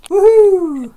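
loud, high woohoo; celebratory whoop. Pretend. Husband announces his anniversary with a celebratory whoop. Cheering own coming anniversary.

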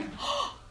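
high startled intake of breath; sharp gasp. Retold or imitated. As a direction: gasp in shock. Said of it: "so freaked out".

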